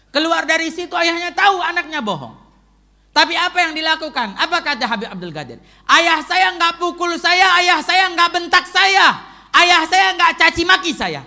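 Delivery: full-force preaching. Acted. Preacher quotes a scholar on his father, crying out at full force. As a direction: build to a ringing proclamation, stretching the repeated phrases.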